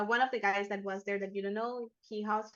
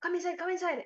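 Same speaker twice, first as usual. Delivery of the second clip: high-pitched urging; urgent beckoning; 9 semitones higher, faster than usual. Acted. Man at the bathroom door urges the group inside, urgent beckoning. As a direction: usher them in, pitch jumping up.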